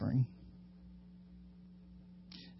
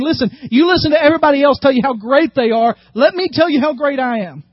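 boastful brag; 6 semitones higher, 4 dB louder than usual. Acted.

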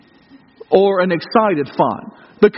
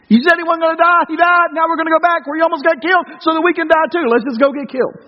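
higher-pitched, incredulous ranting at Jesus; exasperated sarcasm; 7 semitones higher than usual. Retold or imitated. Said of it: "you want to see Thomas back there go".

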